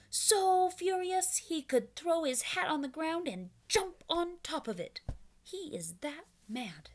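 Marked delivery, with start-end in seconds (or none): none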